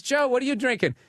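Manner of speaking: high mock question with JOE stressed; piercing stripper voice. Stripper moving on to Joe, high mock voice. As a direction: pitch it up to grate. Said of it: "had a voice that literally could break the glass".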